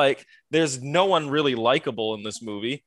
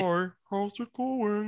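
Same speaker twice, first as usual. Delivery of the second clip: robot voice; 5 semitones higher, 6 dB quieter, slower than usual. Retold or imitated. Robot voice mimic.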